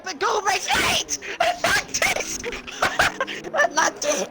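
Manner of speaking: very high screaming call; excited scream. Real, not acted. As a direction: scream the name in disbelief. Co-commentator screaming at the finish.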